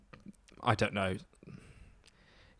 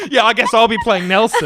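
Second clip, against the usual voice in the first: high, loud, laughing protest; laughing refusal; 13 semitones higher, 8 dB louder than usual. Real, not acted. Host laughs, refusing a dare to do Mandela, high and loud.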